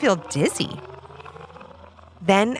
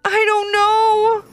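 put-on high, slow miserable boy; hopeless trapped child; miserably; 13 semitones higher, 5 dB louder, much slower than usual. A narrator's reading. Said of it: "Levi answered miserably".

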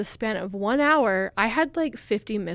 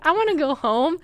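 whiny plea to go home; 7 semitones higher, 5 dB louder than usual. Retold or imitated. Retelling wanting to leave.

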